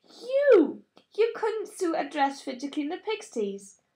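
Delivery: sudden high, loud scornful burst; disdain. A narrator's reading. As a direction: sneer it at your sister. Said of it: "threw back her head in disdain".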